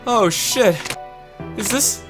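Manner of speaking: usual speaking voice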